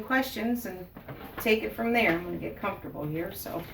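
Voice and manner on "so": ordinary talk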